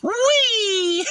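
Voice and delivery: high, loud "Whee!" of glee; gleeful squeal. Real, not acted. To the viewer, gleeful after the fight, a high squeal. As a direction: squeal it with delight.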